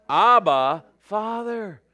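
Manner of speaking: loud ABBA, then high Father; ringing cry from the pulpit. Acted. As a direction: call it out for the congregation to echo. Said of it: "whereby we cry".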